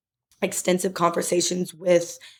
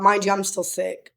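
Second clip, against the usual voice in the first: normal talking voice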